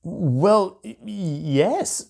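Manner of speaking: a high, faltering stammer; stammering bear. A narrator's reading. The famous bear stammers when the dragon queen asks, stammering. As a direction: trip over the words, flustered. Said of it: "stammered Seymour".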